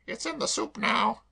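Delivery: high, chirpy cockney maid voice; perky maid reassuring her mistress; Claire the maid. Acted. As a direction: pitch her up and pert. Claire again.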